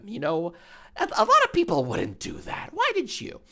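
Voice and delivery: high nosy interviewer grilling Doctor Disrespect; Jiminy Glick voice. Pretend. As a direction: needle the guest with faux-innocent questions. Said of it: "look up Jiminy Glick".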